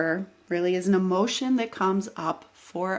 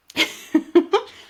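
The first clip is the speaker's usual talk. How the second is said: bright burst of laughter; real laugh; 10 semitones higher, 6 dB louder than usual. Real, not acted. Vlogger laughing at her own rant, amused laugh. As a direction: laugh out loud at yourself.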